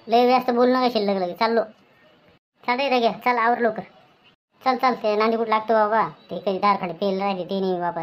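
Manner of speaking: normal talking voice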